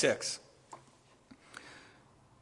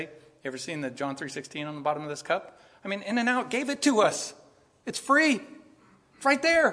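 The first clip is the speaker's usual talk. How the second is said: bright friendly pitch; 5 semitones higher than usual. Retold or imitated.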